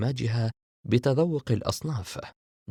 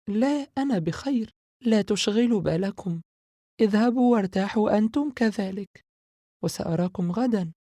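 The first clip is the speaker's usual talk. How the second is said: put-on higher, louder young girl's voice; Sara's voice; 12 semitones higher, 4 dB louder than usual. A narrator's reading. Sara telling her brother not to worry, in a higher girl's voice. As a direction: brush off their worry brightly.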